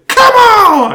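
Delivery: high, loud cry of encouragement; cheering shout. Real, not acted. Hyping up his buddy's run, a loud cheering shout. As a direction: yell it like a fan in the stands.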